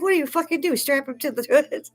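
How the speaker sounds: high mock disbelief; incredulous retort. Answering the daycare guy, high incredulous mockery. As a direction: toss back a high, scoffing question. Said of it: "I was like".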